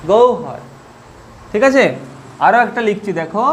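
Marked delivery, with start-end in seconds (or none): 0.1-0.6 গৌহর pronounced gou-har; correct pronunciation demo. Shown as a demonstration. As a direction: model গৌহর as two parts, gou then har. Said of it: "এটা উচ্চারণ করব". (গৌহর উচ্চারণে "গোউ-হর")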